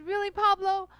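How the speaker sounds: hammed-up proud reply; playful boast. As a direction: show off grandly to tease him. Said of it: "And I said".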